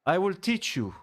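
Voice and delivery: mock Spanish-accented English, playful; lightly put-on Spanish accent teacher voice. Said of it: "I gave him a, a Spanish accent".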